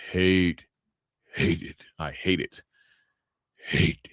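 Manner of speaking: demon voice. Real, not acted. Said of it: "the demon voice".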